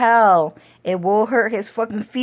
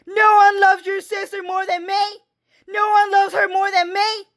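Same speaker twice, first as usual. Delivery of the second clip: much higher, shrill shouting and crying; screaming sister-in-law; 12 semitones higher than usual. Retold or imitated. Sister-in-law yelling at her, crying, shouting. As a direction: scream and sob at the police call. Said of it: "I would hear".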